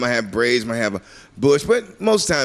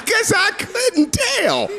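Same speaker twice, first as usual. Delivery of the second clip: much higher, slow, laughing; sarcastic laughing jab; much slower than usual. Retold or imitated. Comic mocking friend's obvious remark, sarcastic. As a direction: drip sarcasm through laughter. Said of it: "sarcastic comeback".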